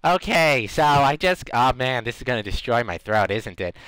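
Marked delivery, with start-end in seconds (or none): none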